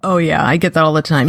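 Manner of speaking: usual speaking voice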